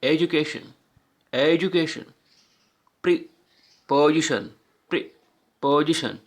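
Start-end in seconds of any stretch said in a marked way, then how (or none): 3.0-4.5 preposition slow, with a pause after pre; broken into syllables. Shown as a demonstration. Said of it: "four syllable भी होते हैं". 4.9-6.2 preposition slow, split after pre; broken into syllables. Shown as a demonstration. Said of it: "four syllable भी होते हैं".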